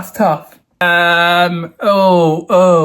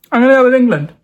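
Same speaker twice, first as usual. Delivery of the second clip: usual speaking voice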